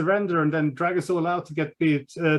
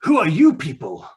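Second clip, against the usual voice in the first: rescued captive. Acted. GM voicing rescued Benedict Rose.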